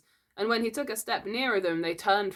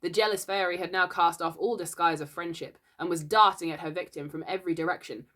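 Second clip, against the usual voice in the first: plain reading voice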